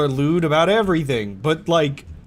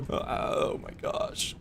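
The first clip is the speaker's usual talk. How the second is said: breathless whispered aside; 9 dB quieter, slower than usual. Real, not acted. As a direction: whisper it, slowly. Laughing aside.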